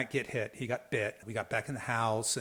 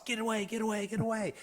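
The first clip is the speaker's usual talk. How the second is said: higher, urgent calls to the dogs; retold yelling; 9 semitones higher, faster than usual. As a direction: shoo the dogs off a snake. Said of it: "we're yelling at the dogs".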